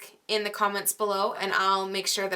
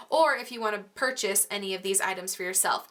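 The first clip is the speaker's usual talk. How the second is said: ordinary delivery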